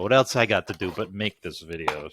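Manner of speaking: ordinary talk, nothing marked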